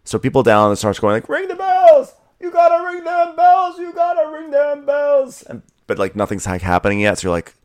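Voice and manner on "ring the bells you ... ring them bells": very high, thin mock shout; high-pitched imitation of desperate shouting; imitated crowd yelling. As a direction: yell it like townsfolk calling up from below. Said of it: "people start yelling".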